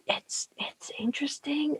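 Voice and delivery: hushed whisper. Real, not acted. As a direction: drop to a secret whisper. Whispered aside about fan theory.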